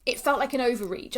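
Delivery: usual speaking voice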